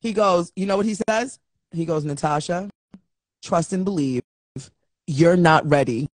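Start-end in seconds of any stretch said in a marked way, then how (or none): 2.0-6.1 low, measured man's voice, long pauses; mock-serious low mentor voice; imitated solemn advice. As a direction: warn gravely, phrase by phrase.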